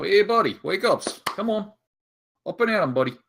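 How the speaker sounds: usual speaking voice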